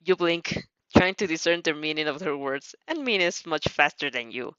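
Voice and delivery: ordinary delivery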